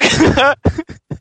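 high nervous giggle; nervous laugh. Real, not acted. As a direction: laugh out the nerves.

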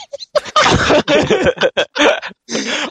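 high rising giggle; long nervous laugh. Real, not acted. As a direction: laugh helplessly.

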